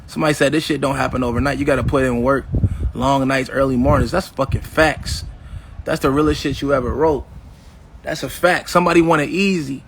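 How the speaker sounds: ordinary talk, nothing marked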